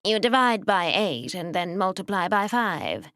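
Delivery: thin, nasal, whining and slow. Acted. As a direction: whine it through the nose. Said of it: "Mister Gray threw in helpfully".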